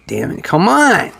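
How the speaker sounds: ordinary delivery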